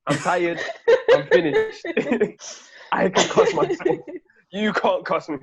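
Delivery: high, loud words broken by laughter; laughing protest. Real, not acted. Teased about turning twenty-five, laughing loudly. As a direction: laugh your way through the mock outrage.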